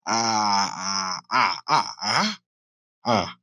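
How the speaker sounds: drawn-out dentist's-chair "ahh"; open-mouth ahh. Shown as a demonstration. Showing the inside of his mouth after surgery, open-mouth ahh. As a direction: say "ah" with the mouth wide open, mostly low and soft. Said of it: "if you could see in my mouth".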